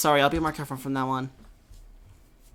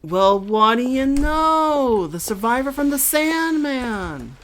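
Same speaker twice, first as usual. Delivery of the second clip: slow, loud, higher drawn-out taunt; menacing stranger; 9 semitones higher, 8 dB louder, much slower than usual. Acted. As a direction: stretch each word like a sinister showman. Same figure again.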